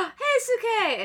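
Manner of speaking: a high, slow cry of alarm; alarmed shout of his name. Retold or imitated. Crying out for the hero.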